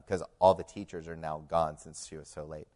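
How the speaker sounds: usual speaking voice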